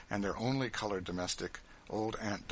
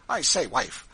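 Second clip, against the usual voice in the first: loud, higher sudden hail; breaking out; 4 semitones higher, 9 dB louder than usual. A narrator's reading. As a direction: burst out to your wife, voice raised. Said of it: "Mister Bird again broke out".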